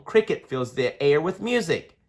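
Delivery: plain reading voice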